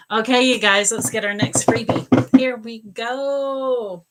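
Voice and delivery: loud host call-out, stretched at the end; rally the room, drawing out the last words; upbeat announcing. Real, not acted. Host calls out next freebie.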